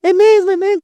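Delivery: delighted, can't believe it; excited disbelief. Retold or imitated. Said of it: "Eu falei".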